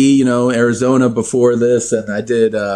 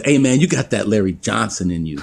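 coach impression; 4 semitones lower than usual. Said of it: "He goes".

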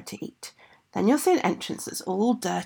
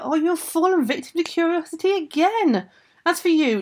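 higher-pitched storybook reproach; scolding; 8 semitones higher, 4 dB louder than usual. Acted. King scolds Rosella for her curiosity, scolding. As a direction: chide with raised pitch and emphasis.

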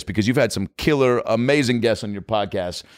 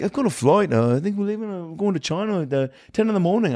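high, hesitant caricature; 8 semitones higher than usual. Retold or imitated. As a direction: make excuses in a high put-on voice. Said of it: "He's like".

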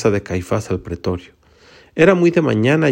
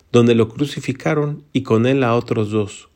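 ordinary delivery, nothing marked

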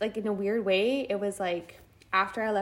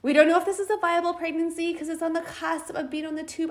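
doctor voice; 8 semitones higher than usual. Retold or imitated. Quoting the gynecologist.

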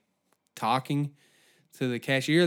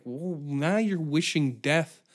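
usual speaking voice

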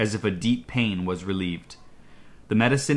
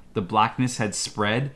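ordinary delivery, nothing marked

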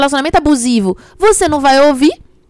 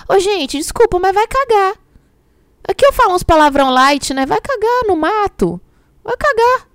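much higher, fed-up telling off; exasperated; 9 semitones higher than usual. Real, not acted. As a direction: blurt it out fed up. Exasperated cursing at partner.

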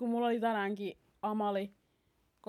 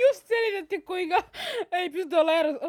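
higher and louder protest; excited protest; 10 semitones higher, 9 dB louder than usual. Real, not acted. Protesting to her twin, loud and amused.

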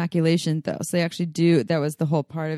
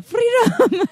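high, loud Braveheart-style "Freedom!"; yelled battle cry; 5 dB louder than usual. Retold or imitated. Recalling her podcast yell, a high loud battle cry. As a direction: shout it like a rebel charging. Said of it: "you yell".

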